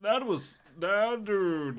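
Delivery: high, loud character voice attempt; trying out Brian. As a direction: hunt for the big dad voice. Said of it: "What do I sound like? 'Cause it's not this".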